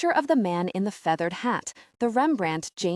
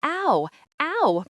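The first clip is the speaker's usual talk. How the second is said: high yelps of mock pain; a child's squealing ow under a heavy dog; yelling ow; 8 semitones higher, 4 dB louder than usual. A narrator's reading. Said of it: "she starts yelling".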